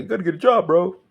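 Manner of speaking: high, loud whiny mock of people; high mocking nag; mocking nagging voice. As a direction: sneer it like a nagging busybody.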